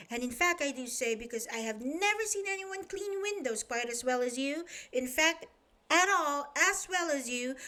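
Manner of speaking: fast, high, breathless chatter. Acted. Chatterbox flattering the window cleaner, fast high chatter. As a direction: rattle on without stopping. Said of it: "he said to the window cleaner".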